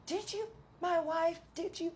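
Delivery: high-pitched imitation of screaming; mock scream. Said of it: "he's screaming at Richie".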